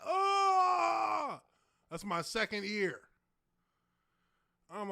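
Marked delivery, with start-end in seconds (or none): 0.1-1.4 long, loud, high "ohhh" holler; drawn-out high cry of reaction; high exclaimed "oh". Real, not acted. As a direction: wail out one held high "oh". Reacts to picture of himself struggling.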